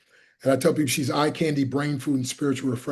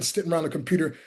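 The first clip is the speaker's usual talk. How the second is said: ordinary delivery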